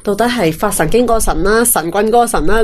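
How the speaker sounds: normal talking voice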